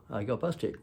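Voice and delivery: ordinary talk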